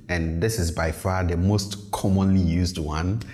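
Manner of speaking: ordinary talk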